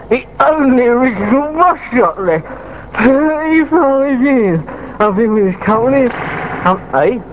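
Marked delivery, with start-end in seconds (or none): 0.1-6.1 high, echoing radio announcement. Real, not acted. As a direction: draw it out high with an echo on it. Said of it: "They put me on echo".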